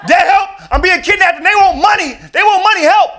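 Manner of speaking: high, loud panicked screaming; frantic high yelling into the phone; panicked scream for help. Acted. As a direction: shriek for dad like you're being kidnapped. Kidnap call reenacted again.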